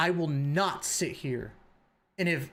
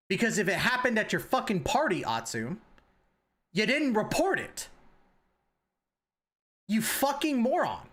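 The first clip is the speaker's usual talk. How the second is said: higher-pitched, exasperated outburst; real anger, building to the final insult; angry accusation; 4 semitones higher than usual. Real, not acted. Accusing Atsu of not reporting it, angry.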